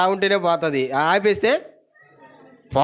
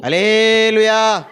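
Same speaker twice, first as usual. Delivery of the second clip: slow, high, loud hallelujah; hallelujah cry; 7 semitones higher, 7 dB louder, much slower than usual. Real, not acted. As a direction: stretch out a joyful hallelujah. Preacher's exclamation after verse. (దీర్ఘంగా హల్లెలూయా కేక)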